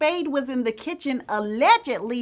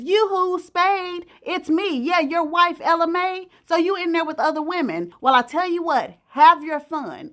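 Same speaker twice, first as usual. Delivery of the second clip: sweet, higher mock sing-song call through the door; 5 semitones higher than usual. Wife taunts cheating husband at door.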